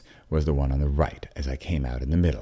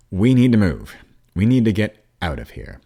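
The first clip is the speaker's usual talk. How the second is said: firm, loud parent command; louder, firm order to move out; with authority; 6 semitones higher, 7 dB louder than usual. A narrator's reading. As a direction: take charge, no arguing. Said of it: "I said with authority".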